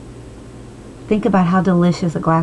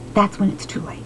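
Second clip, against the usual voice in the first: hushed, breathy grave warning; whispered, almost to herself; 6 dB quieter than usual. Real, not acted. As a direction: drop to a whisper for the punchline. Grave warning about death.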